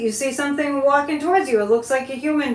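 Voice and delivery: ordinary talk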